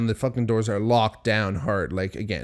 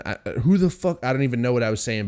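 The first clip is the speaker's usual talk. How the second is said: normal talking voice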